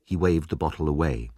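ordinary delivery

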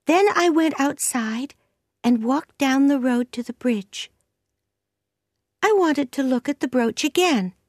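put-on high-pitched girl telling her tale; high, earnest young voice; confessing young Anne.

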